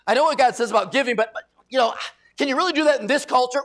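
high, loud skeptical protest; hedge, then challenge insistently; acted incredulous doubter; mock doubting challenge. Mimics doubters challenging God's word.

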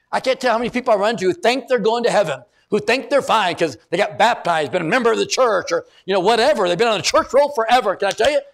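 urgent, emphatic sermon voice; impassioned preaching. Acted. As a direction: drive the point home to the congregation. Preaches at high intensity.

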